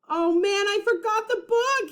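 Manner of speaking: high, dismayed outburst. A narrator's reading. Jack realizing he forgot the book, high dismay. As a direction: realize it with a jolt. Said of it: "he said".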